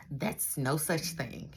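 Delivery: ordinary delivery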